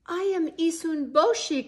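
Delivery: high, loud call; crying out. A narrator's reading. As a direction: cry out his name proudly. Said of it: "cried the young warrior".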